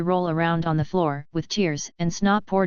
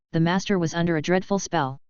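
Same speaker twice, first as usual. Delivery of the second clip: plain reading voice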